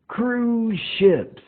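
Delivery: very high, loud cruise ships squeal; mock squeal. Musician squealing about playing cruise ships, mock horror.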